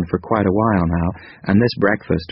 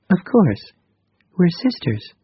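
higher, gentle female character voice; 11 semitones higher, slower than usual. A narrator's reading. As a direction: voice Krita Kano lightly higher. Narrator voices Krita Kano.

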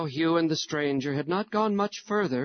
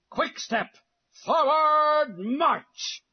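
barked military command, drawn out; gruff shout; 12 semitones higher, 3 dB louder, much slower than usual. Acted. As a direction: yell the drill order like a captain. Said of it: "shouted a gruff voice".

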